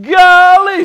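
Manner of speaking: ordinary talk, nothing marked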